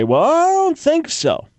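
sing-song high I don't think so; mock objector. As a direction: parody the doubter's scoff. Mocking a dismissive objector.

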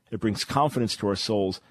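ordinary talk

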